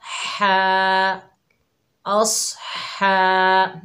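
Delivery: हा breathed from the middle of the throat. Shown as a demonstration. Teacher demonstrating the throat letter to students, throaty. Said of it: "हा को हलक के दरमियान से अदा करेंगे".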